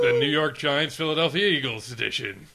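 slow, drawn-out game show intro; show announcer. Acted. Host introducing the movie game, announcer voice. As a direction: announce the segment with big, stretched-out words.

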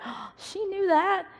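high, slow, put-on admiring voice; impressed listener. Imitating the crowd she hoped to impress, high and slow. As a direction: mimic someone going wow.

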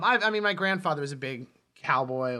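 ordinary delivery

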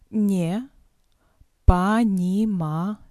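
ordinary talk

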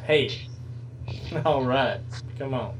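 ordinary talk

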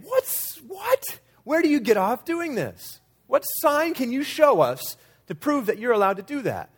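high-pitched outraged challenge; angry. Acted. Temple leaders confronting Jesus, angrily. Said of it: "angrily saying".

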